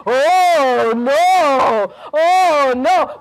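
loud, drawn-out swooping moan; moaning bear. A narrator's reading. Bear telling another bear the news, moaning. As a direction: wail each word long like a bear. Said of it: "he was moaning".

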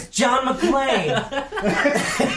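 high, very slow laughing drawl. Real, not acted. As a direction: stretch it out laughing. Laughing mock-drama over hero.

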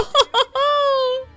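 a quick burst of high delighted laughter; giddy high laugh. Real, not acted. Gamer laughing as the level starts, high giggle.

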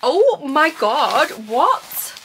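breathless, high and loud, drawn out in shock; stunned surprise. Real, not acted. Opening a surprise gift box, stunned high gasping disbelief. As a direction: gasp it out, floored by the gift.

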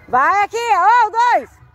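high, loud, thrilled cry to the dogs; excited calling out. Real, not acted. Owner cheering on her two dogs, high and loud.

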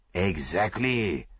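put-on slow, pleased accented confirmation; beaming. A narrator's reading. Detective confirms his friend has caught on, beaming. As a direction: stretch it out with satisfaction.

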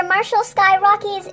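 usual speaking voice

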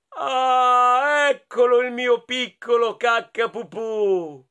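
joyful dad. Acted. Papa rabbit finding his little one, loud and glad. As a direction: greet the found child gladly.

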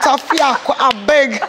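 lightly put-on Nigerian accent; a quick Nigerian accent line. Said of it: "in a Nigerian accent".

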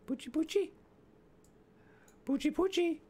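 high sing-song call for a pet; calling a dog. Real, not acted. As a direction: coax the dog out, high and sweet.

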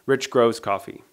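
ordinary delivery

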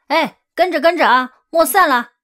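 loud high calls through the rain; shouting. A narrator's reading. Porters running in the rain call to each other, loud shout. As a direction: yell to keep the group together. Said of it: "一边跑一边喊".

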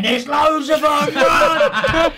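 high, drawn-out warning yell; shouted fake panic; mock alarm shout. Acting his fake alarm, calling out.

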